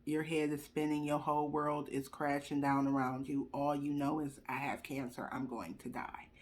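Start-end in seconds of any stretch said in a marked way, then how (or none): 4.5-6.3 low, hushed despairing thought; quiet dread, lower and softer; inner panic voice. Retold or imitated. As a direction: murmur it like the thought in your head. Inner thought on hearing diagnosis.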